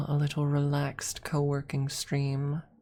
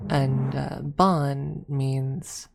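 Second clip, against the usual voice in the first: normal talking voice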